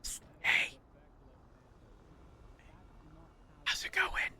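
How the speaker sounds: sneaky whisper. Acted. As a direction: breathe it out like a secret. Sneaking up in the bank.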